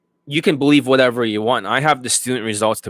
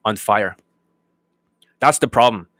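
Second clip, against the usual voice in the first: usual speaking voice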